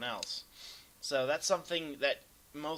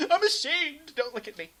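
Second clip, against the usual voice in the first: hammed-up squeal of embarrassment, high and loud; mock shame; 14 semitones higher, 10 dB louder than usual. Caster joking to his viewers, mock ashamed squeal. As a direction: fake being mortified for the viewers. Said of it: "No, I'm just kidding".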